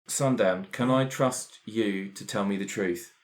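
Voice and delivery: ordinary delivery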